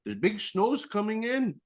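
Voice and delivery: high, pushy salesman voice; imitating an eager dealer; mock salesman upsell. Dealer explains, mimicked.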